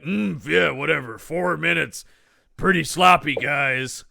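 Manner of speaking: grinding stone gravel voice; rough wrestler growl, unhurried; gravelly Hulk voice. Retold or imitated. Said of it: "[name], you can't use that voice".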